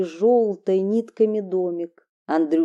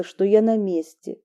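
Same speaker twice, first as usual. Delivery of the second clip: plain reading voice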